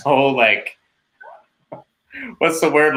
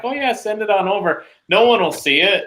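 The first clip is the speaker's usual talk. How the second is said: mock breezy quote; 9 semitones higher than usual. Mocking the administration's careless reply.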